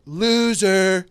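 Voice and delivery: all-caps shout. Acted. Host dictating the next tweet, all-caps shout. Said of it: "all caps".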